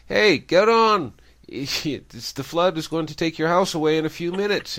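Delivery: high, loud holler from a helicopter; acted shouting, pitched well up; urgent shout. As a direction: call down an urgent warning. Helicopter crew calling down to old man.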